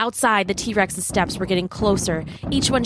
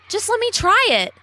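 put-on high, pleading boy's voice; high kid voice; 14 semitones higher than usual. A narrator's reading. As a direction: beg like an eager little boy. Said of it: "he said".

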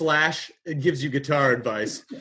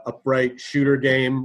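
ordinary delivery

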